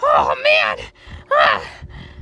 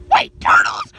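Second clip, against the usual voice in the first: ordinary talk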